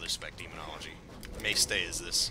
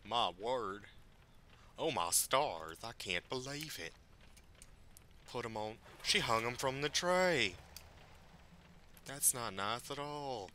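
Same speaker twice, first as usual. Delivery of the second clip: mock scandalized; 3 semitones higher, slower than usual. Mock-prim shock at grim quest.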